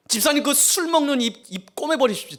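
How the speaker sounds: high, loud scolding of a drinker; mock harsh command. Preacher pretending to scold a drinking deacon, loud mock harshness. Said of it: "막 이런다면 여러분".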